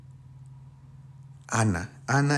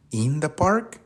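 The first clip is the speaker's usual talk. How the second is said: testing guess; 6 semitones higher, slower than usual. Shown as a demonstration. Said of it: "No, ¿cierto?".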